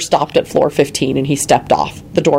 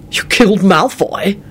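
a surprised gruff man; slow, delighted disbelief; Quentin, surprised; 5 semitones higher, slower than usual. A narrator's reading.

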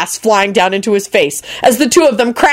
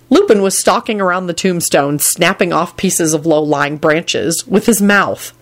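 usual speaking voice

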